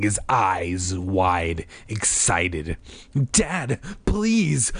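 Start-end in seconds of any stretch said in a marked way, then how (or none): 3.3-4.7 higher-pitched drawn plea; alarmed, pleading cry. A narrator's reading. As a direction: beg a father, pitch raised. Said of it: "Margaret cried".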